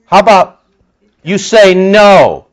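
loud, slow rallying call; urging shout. Real, not acted. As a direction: call out the challenge, drawn out. Urging listeners to refuse.

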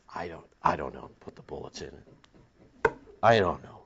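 low, very soft mock uncertainty; evasive mumbled answer. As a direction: back off under your breath. Mimics evasive answer to objector.

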